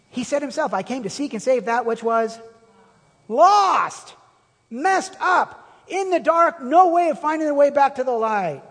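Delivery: rising, loud preacher's cry; impassioned preaching. Acted. Preacher quoting Jesus to the congregation, impassioned and loud. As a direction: preach it out with full fire.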